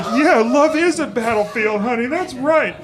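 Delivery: loud, high excited whoop; hyped rocker. Retold or imitated. Comic retelling his shout to the band's singer, hyped. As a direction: cheer along like a rowdy rock fan. Mimics rocking band singer onstage.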